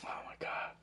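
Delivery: whispered under his breath. Retold or imitated. To himself after the double dare, a whispered oh my God. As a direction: breathe it like a scared kid. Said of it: "I was like".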